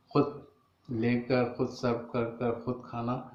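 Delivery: ordinary talk, nothing marked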